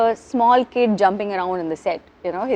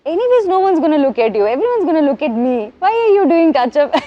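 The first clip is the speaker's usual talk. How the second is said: raised, louder playful telling-off; mock scolding; 6 semitones higher, 5 dB louder than usual. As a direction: imitate a cheeky co-star chiding a colleague. Acts co-star teasing her over touch-up.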